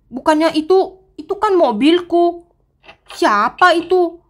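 staring at her burning car in shock; shocked dismay. Acted. Owner finds her car burning.

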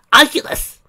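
high, loud anguished cry; shouting. Acted. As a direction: cry out her name. Mother calls to fallen daughter.

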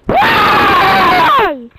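very high, very loud anguished scream, then slow groan; rage scream. Real, not acted. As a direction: scream in real despair at the game.

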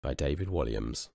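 lively storybook reading voice; story narration. A narrator's reading. Narrator opening the book for young listeners, lively storytelling. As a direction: read it out like a bedtime story.

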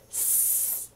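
"S" as a long unvoiced snake hiss. Shown as a demonstration. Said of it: "So you will say".

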